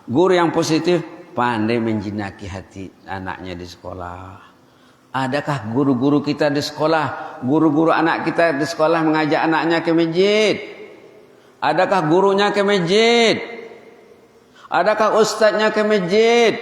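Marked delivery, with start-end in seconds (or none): 5.1-16.5 higher and louder rhetorical questions, building; fiery sermon questions; rising preaching challenge. Real, not acted. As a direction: preach it out to the congregation.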